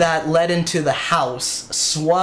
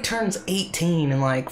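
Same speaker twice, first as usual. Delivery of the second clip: ordinary delivery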